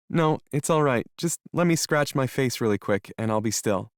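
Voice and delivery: put-on sniffly young woman character. Melody's voice, sniffly.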